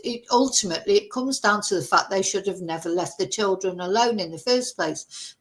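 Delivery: ordinary talk, nothing marked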